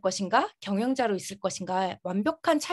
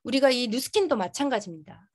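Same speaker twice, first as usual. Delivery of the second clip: normal talking voice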